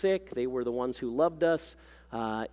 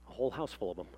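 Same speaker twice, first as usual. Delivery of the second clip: low, soft undertone; dry aside; 5 semitones lower, 7 dB quieter, much faster than usual. A narrator's reading. Mother confides between her grand claims, dry low aside. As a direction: drop it in as a private comment.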